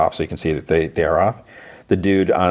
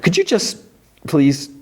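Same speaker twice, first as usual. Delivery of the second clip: exasperated plea; 11 semitones higher than usual. Retold or imitated. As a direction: pitch leaps way up, exasperated. Carol exasperated, wants silence.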